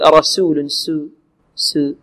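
رسول with the س made too thin and faint; thin the سو of رسول too much, softly; overthinned s. Shown as a demonstration. Teacher imitating over-light sin mistake. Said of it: "ولا نبالغ في ترقيق السين".

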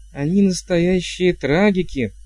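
high-pitched, awed reverence; young witch's worshipful admiration; reverent awe. A narrator's reading. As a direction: gush with hushless youthful reverence. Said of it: "насыщен таким благоговением".

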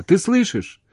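very high urgent question; anxious question. A narrator's reading. Man fearing she is gone, anxious. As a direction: check anxiously that she is there. Anxious check after her silence.

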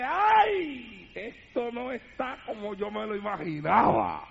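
queja fingida que cae a grave y suave; laméntate como quien está por rendirse; lamento desanimado. Acted. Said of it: "Pues si no te llamaron, te rinde". (lamento actuado de desánimo)

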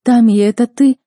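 loud, high, urgent cry; shouted question. A narrator's reading. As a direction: cry out in desperate hope. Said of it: "закричала я". (громкий крик с надеждой)

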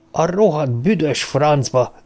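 furious slow oath; swearing. A narrator's reading. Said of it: "szitkozódott".

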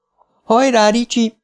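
very high-pitched cheer; high, urgent encouragement. A narrator's reading. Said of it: "hallotta Bev a saját hangját".

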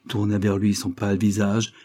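usual speaking voice

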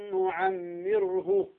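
نعمره with nasal ghunna held two counts. Shown as a demonstration. Teacher demonstrating measured ghunna. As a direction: hold the ghunna in نعمره for two beats, slowly. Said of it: "أن نظهر الغنة بمقدار حركتين".

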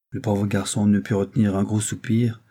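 ordinary delivery

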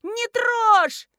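high, loud alarmed wail; shrieking. A narrator's reading. Young woman defends her powder from mother-in-law, shrieking. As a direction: shriek to protect your things. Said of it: "завопила девица-красавица".

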